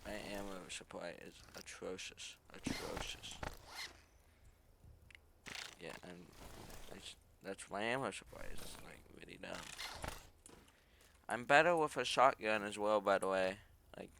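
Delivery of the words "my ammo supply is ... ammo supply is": hushed muttered complaint; whispering. Real, not acted. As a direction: whisper it in disgust. Whispered disgruntled aside.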